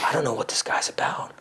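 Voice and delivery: imitating a woman whispering her doubts; breathy hushed wondering to herself; mock girl's whispered inner voice; whispered musing. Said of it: "Women love the idea of not knowing".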